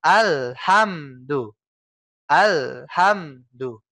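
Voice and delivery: Alhamdu with the l sound stopped short; cut-off sukun. Shown as a demonstration. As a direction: brake hard on the sukun in Alhamdu. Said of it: "Keputus, ya".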